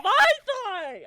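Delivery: outraged exclaim. Real, not acted. Telling a friend about the date's hand on her thigh, loud outraged cry. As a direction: blurt it out in disbelief. Outraged retelling of unwanted touch.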